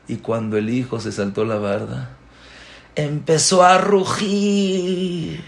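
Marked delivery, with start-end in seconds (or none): 2.9-5.4 high, long-drawn storyteller's roar; drawn-out imitation of roaring; roar-like telling. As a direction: stretch the word out like a mock roar. Dramatizes the lion's roar.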